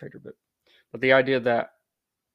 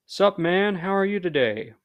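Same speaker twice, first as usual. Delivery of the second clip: higher, louder, buddy-style greeting; loud friendly American; 8 semitones higher, 4 dB louder, slower than usual. Shown as a demonstration. Mock American greeting a friend, loud and friendly. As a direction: bounce in like an outgoing American. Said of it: "we can see that reflected in our language".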